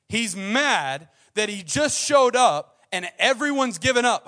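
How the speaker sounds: loud, high, impassioned preaching; preaching at full force. Acted. Preacher urging the congregation, loud and impassioned. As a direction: drive the point home to the crowd.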